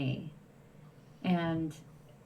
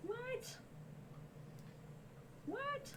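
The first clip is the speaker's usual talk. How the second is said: two very high, soft incredulous whats; shocked disbelief; 14 dB quieter than usual. Real, not acted. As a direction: squeak out real disbelief. Shocked at a March frost date.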